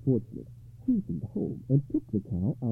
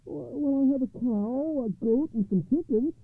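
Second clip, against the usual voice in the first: high, worried little voice; high-voiced anxious villager; troubled poor man; 12 semitones higher than usual. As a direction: answer meekly in a high-pitched voice. Poor man answers the rabbi.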